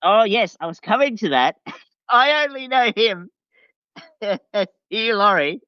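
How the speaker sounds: normal talking voice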